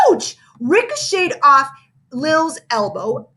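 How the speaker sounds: plain reading voice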